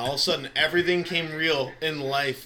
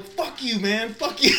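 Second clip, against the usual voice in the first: mock yelled cussing; 7 semitones higher than usual. Retold cussing out teammate.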